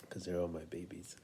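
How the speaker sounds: softly whispered fond aside. Real, not acted. Father whispering to viewers about his kids, tender whisper. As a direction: breathe it out as a private aside.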